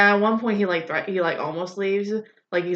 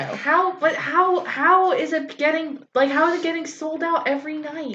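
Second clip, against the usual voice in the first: high, halting disbelief; raised-pitch, incredulous bickering; baffled exasperation; 7 semitones higher than usual. Real, not acted. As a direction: press the question in comic bafflement. Baffled challenge in playful argument.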